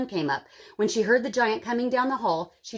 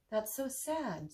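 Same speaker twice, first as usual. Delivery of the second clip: much softer, slow and hushed sorrow; softly sad; 12 dB quieter, slower than usual. A narrator's reading. Kate hearing of the starving widow, soft slow sorrow. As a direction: let the sadness sink in quietly. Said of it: "said Kate".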